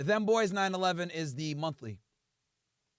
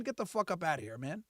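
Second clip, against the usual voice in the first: usual speaking voice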